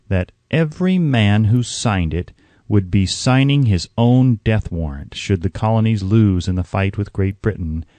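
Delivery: plain reading voice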